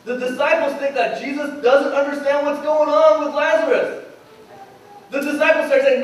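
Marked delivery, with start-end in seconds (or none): none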